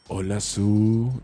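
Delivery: slow, low sensual greeting. Pretend. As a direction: purr it slowly and seductively. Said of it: "Léase con tono sensual".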